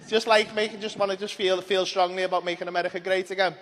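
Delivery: normal talking voice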